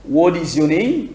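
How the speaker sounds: ordinary delivery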